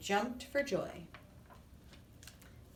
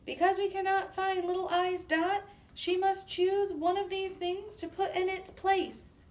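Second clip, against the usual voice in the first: much higher and louder announcement; speaking loudly; 11 semitones higher, 5 dB louder than usual. Acted. Capital I addressing the lined-up letters, loud high announcement. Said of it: "Capital I spoke loudly".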